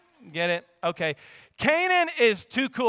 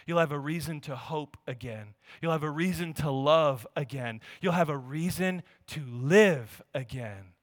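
preaching; 5 semitones lower, 4 dB quieter than usual. Acted.

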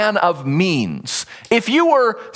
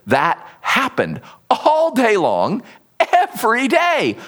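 ordinary talk

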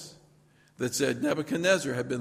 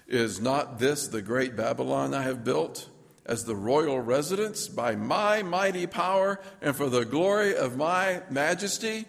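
King Nebuchadnezzar; 4 dB louder than usual. Acted. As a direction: proclaim it proudly, leaning on each grand phrase. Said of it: "I was high and mighty".

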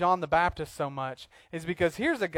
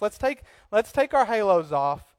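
usual speaking voice

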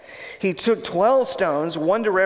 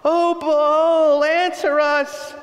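high, loud pleading cry to Baal; desperate shout; 10 semitones higher, 4 dB louder, much slower than usual. Retold or imitated. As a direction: call out to a silent god, drawing it out. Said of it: "These guys are shouting".

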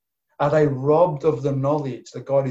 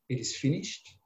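weak breathy; 8 dB quieter than usual. Retold or imitated. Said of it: "gasping for breath".

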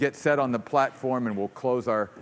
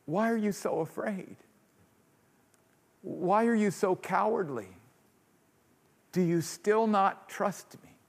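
soft, gentle reassuring questions; tender and loving; 5 semitones higher, 3 dB quieter than usual. Acted. Jesus gently asking fearful followers, tender. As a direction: coax them out of their fear. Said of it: "tenderly and lovingly look at us".

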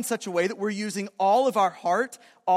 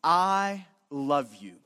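tender declaration; 7 semitones lower than usual. Acted. Man looking at his wife and saying I love you, tender. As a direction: lower the voice and let each word linger lovingly. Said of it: "looking at that lady and saying".

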